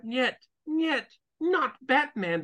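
high, slow Russian operator voice; Russian accent. Retold or imitated.

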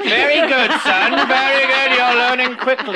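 proud father voice. Pretend.

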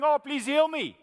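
a hint of a whiny plea; mock his own pleading prayer; self-pity plea. Preacher imitating himself begging God, lightly whining. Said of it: "I was having a pity party".

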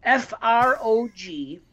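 slow letter by letter spelling; spelled out. Shown as a demonstration. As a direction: spell out each letter slowly. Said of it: "I'll spell it for you".

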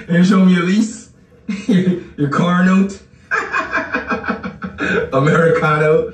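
high-pitched taunting through laughter; laughing mockery. Real, not acted. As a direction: jeer while cracking up. Ridiculing viewer between laughs.

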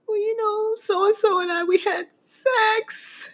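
very high, drawn-out tearful wail; mock bawling confession. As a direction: squeak it out through exaggerated sobs. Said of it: "And I just start crying".

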